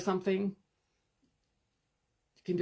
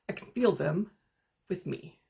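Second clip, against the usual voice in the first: ordinary talk, nothing marked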